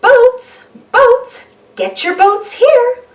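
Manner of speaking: high, loud vendor cry, slowing on the last words; calling out. Acted. Miss Rabbit calls out to the boating families, high and loud. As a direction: shout it like a market seller. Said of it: "calls Miss Rabbit".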